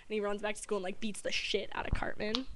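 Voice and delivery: usual speaking voice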